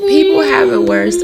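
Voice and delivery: "people have it worse" wailed high and loud through tears; real sobbing cry on "people have it worse"; sobbing repeat. Real, not acted. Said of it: "But, like".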